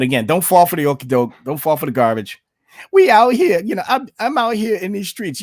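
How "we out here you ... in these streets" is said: high, loud mocking of a politician's slang; mock street-talk impression. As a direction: ham it up like a politician faking street cred.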